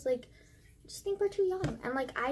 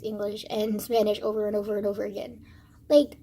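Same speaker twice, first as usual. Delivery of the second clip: ordinary delivery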